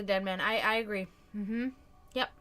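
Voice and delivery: usual speaking voice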